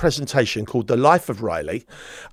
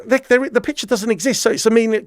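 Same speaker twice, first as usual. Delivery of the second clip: ordinary delivery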